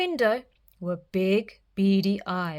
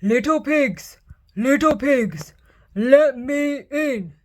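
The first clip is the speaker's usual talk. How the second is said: louder, slowing wolf demand; howling wolf; 4 semitones higher, 5 dB louder than usual. Acted. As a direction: howl it at the door. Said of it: "howled the wolf".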